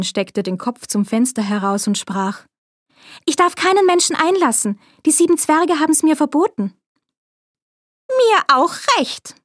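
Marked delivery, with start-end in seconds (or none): 3.2-6.7 Schneewittchen. A narrator's reading. Schneewittchen spricht. 8.1-9.4 Bäuerin. A narrator's reading. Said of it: "antwortete die Bäuerin".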